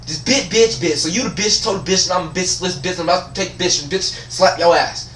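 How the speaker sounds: high, run-on cursing tirade; mock inner rant. Student silently cursing out administrator, mock-furious rant. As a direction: let the insults spill out in a high ranting stream. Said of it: "I'm thinking in my head".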